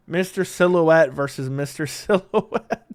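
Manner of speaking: slowed down, breaking up into laughter; can't hold the laugh in; laughing hard. Real, not acted. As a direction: announce the matchup and crack up. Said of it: "I know I'm laughing so hard".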